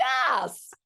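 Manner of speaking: high, loud, overjoyed yes; mother answering her daughter with a delighted yes; emphatic yes. Retold or imitated. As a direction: blurt it out with relief. Mom's tearful yes.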